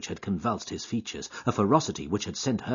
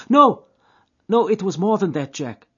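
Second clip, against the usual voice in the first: put-on high, louder voice of an anxious girl; young woman, distressed; 10 semitones higher, 9 dB louder than usual. A narrator's reading. Etty questioning Jack's guilty fear, high distressed voice. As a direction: press him, hurt and suspicious.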